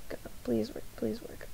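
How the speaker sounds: very soft, hushed pleading; pleading with a machine. Real, not acted. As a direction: beg it under your breath, almost praying. Begging the stream software to work.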